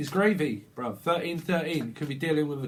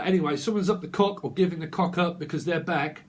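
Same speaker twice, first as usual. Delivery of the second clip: ordinary talk, nothing marked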